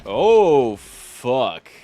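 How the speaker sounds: sudden loud gasp of alarm; shocked outburst. Real, not acted. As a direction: blurt it out in shock. Sees nine enemies appear.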